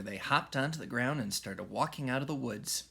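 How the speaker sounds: plain reading voice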